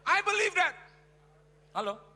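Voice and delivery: shouted conviction, high; preacher's loud affirmation; loud declaration. Real, not acted. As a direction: proclaim it loudly. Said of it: "Yakin saya".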